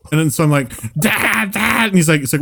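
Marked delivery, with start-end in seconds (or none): 0.9-1.9 high cry for Dad; scared kid calling out. Acted. Frightened child calls dad after hearing clawing, high panicked cry.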